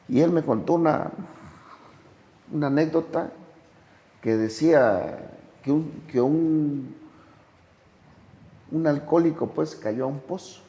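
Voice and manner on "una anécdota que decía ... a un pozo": ordinary talk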